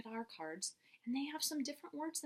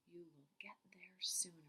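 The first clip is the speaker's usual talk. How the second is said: whisper; 4 semitones lower, 6 dB quieter than usual. Real, not acted. Class reads along quietly.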